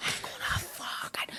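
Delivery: hissed low threat, unvoiced; threatening whisper. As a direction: whisper it like a menacing uncle. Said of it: "see the threats?".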